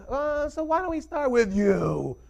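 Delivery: high, slow teacher voice; sing-song teacher asking; sweet teacher voice. Retold or imitated. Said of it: "she goes".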